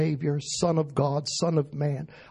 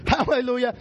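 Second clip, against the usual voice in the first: ordinary talk, nothing marked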